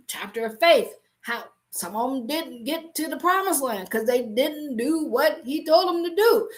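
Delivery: ordinary talk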